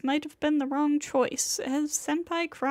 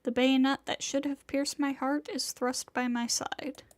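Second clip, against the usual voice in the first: ordinary delivery, nothing marked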